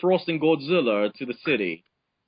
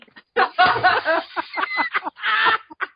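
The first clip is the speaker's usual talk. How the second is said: squealing burst of laughter; high laughing fit; 5 dB louder than usual. Real, not acted. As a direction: crack up laughing. Cracking up mid-read.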